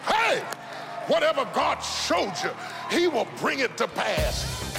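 ordinary talk, nothing marked

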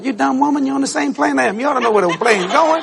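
hammed-up disbelief at a silly question; mock indignation. Passenger silently answers the woman's silly question, mock indignant.

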